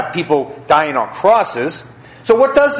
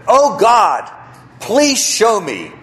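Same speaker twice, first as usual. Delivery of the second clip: 1 pleading prayer; 5 semitones higher, slower than usual. Acted. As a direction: call out to God, then ask slowly. Praying in the retold story.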